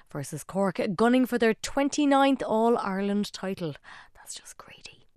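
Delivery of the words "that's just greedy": whisper. Real, not acted. Presenter's whispered aside on the final.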